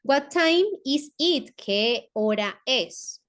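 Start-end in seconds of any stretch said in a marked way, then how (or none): 0.0-1.5 "What time is it?" said slowly and a bit higher; slow teacher's model question; slow English model. Shown as a demonstration. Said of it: "El siguiente".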